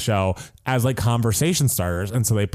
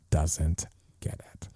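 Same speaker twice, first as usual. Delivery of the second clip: hushed aside, breathy whisper; 8 semitones lower, 8 dB quieter, slower than usual. Real, not acted. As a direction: drop to a conspiratorial whisper. Gossiping about Becky.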